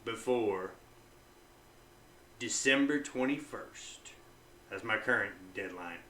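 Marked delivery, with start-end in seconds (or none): 0.0-4.1 hushed, halting warning to himself; negative self-talk. Shown as a demonstration. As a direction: set yourself a grave deadline, softly and slowly. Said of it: "So I'll say".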